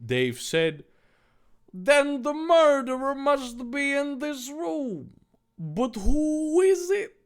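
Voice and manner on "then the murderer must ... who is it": high, drawn-out put-on voice; pondering sleuth. Dave deducing who killed the victim, pondering. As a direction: puzzle it out in a high character voice.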